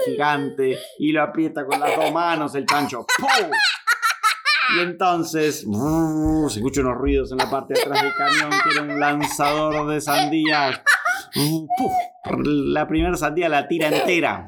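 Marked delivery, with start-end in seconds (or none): 3.2-3.5 high, sharp bang noise; cartoon pum. Pressing big button. 11.4-11.9 very high whoop then puff; cartoon launch noise; whoosh puff. Watermelon launched.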